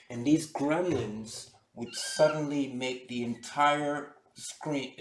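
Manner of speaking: usual speaking voice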